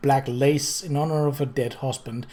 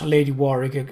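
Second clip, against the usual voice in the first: ordinary delivery, nothing marked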